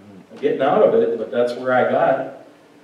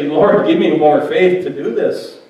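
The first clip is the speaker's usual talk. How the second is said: loud, raised, pleading prayer; crying out; 6 semitones higher, 7 dB louder than usual. Acted. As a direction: cry it out to the Lord, higher and louder. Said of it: "We need to cry out".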